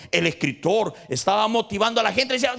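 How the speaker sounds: high, loud fervent preaching; rally the congregation, voice lifted; preaching at full force. Acted. Preacher rallying the congregation, high and loud fervent call.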